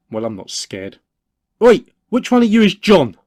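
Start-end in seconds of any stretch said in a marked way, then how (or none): 1.6-3.2 loud, high call across to a group; shout. Acted. Calling out to the lads to find John, loud and high. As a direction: bold shout to catch their attention.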